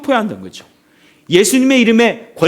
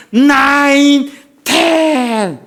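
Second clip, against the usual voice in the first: very slow, loud, high drawn count; referee's count; 5 semitones higher, 4 dB louder, much slower than usual. Retold or imitated. Preacher acts the referee reaching ten as Rocky rises, drawn-out count. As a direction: stretch the count out like the last seconds.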